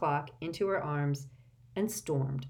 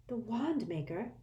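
put-on softer, higher startled girl; quietly taken aback; 5 semitones higher, 5 dB quieter than usual. A narrator's reading. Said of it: "looking startled".

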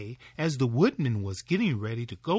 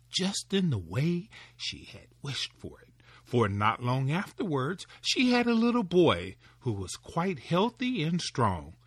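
ordinary delivery, nothing marked

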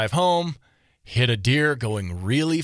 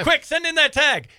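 mock urgent; 12 semitones higher, 3 dB louder than usual. Mock urgent app instruction.

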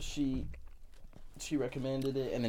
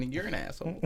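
usual speaking voice

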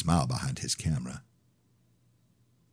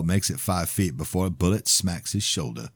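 usual speaking voice

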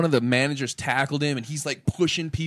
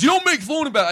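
much higher, louder mock yell; angry heckler; 7 dB louder than usual. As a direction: heckler yelling back at the comic. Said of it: "And he goes".

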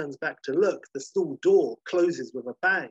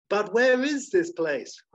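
high, slow, louder pleading question; insistent question; 7 semitones higher, 5 dB louder, slower than usual. Acted. Sarah pressing the drowsy sloth for an answer, high and insistent.

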